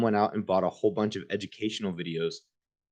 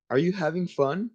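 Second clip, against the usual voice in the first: high, louder mom voice, fake-sweet; sly mom; 10 semitones higher, 4 dB louder than usual. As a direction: set the trap like a mom about to pounce. Mom springs punishment.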